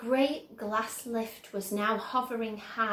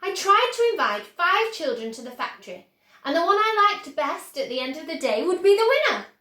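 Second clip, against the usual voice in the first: higher, louder, fast excited Wonka; 7 semitones higher, 10 dB louder, faster than usual. A narrator's reading. Wonka explaining the golden tickets, excited. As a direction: rattle it off gleefully.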